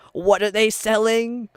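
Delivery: high, drawn-out mock cartoon quote; SpongeBob old lady. Friends quoting a cartoon at the speaker's accent, mock old-lady voice. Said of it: "the old lady from SpongeBob".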